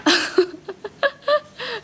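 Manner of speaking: high bursting laugh. Real, not acted. Laughs at being underestimated.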